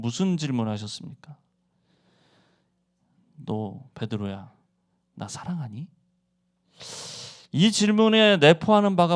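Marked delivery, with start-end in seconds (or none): 3.4-5.9 slow, much softer, lower and gentle; Jesus gently asking Peter, tenderly; tender question. Acted. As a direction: ask it softly, like to a hurt friend.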